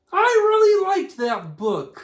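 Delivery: high, loud cartoon puppet; chirpy little puppet voice. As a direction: puppet Fred gushing to the host. Puppet Fred answers host.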